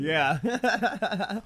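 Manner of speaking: bright laughing yes; laughing yeah. Real, not acted. Laughs after big yes.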